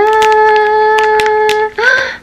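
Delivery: sharp rising gasp of delight. Real, not acted. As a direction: gasp at the surprise. Reveal of the toy pet.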